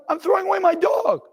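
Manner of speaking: high, anguished shout of disbelief; a raw, pained yell over the trash can; anguished cry. Retold or imitated. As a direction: cry it out as he drops his dog in the trash. Putting his dead dog in trash.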